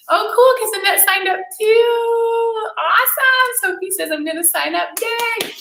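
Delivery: high, slow gushing; excited squeals. Real, not acted. Host reading new sign-ups in the chat, excited squeals. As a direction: gush over each new sign-up.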